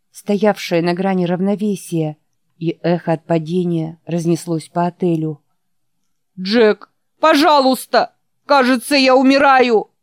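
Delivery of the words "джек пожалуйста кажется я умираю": high, loud desperate begging; helpless plea. Acted. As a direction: call out as if almost gone. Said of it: "Беспомощный голос Ричарда звучал так".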